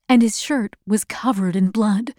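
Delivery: usual speaking voice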